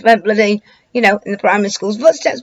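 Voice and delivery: usual speaking voice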